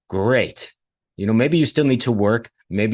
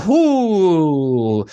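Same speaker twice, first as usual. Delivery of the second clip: mock realization; 6 semitones higher, 5 dB louder than usual. Realizing past foolishness.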